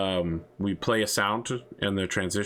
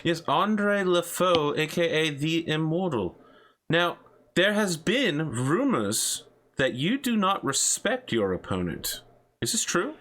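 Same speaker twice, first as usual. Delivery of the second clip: lightly voiced talk show host; upbeat broadcast host voice; 8 semitones higher than usual. Hosts the in-game show.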